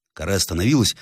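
ordinary delivery, nothing marked

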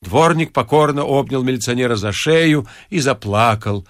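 usual speaking voice

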